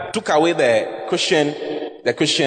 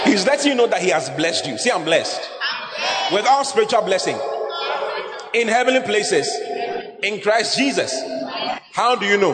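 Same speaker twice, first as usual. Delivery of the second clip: preaching at full force; 5 semitones higher than usual. Acted. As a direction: proclaim the blessing to the congregation. Preaching builds to shouts.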